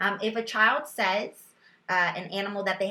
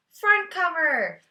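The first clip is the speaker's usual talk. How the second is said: put-on child's answer; 7 semitones higher, 6 dB louder, slower than usual. Teacher voicing child's answer.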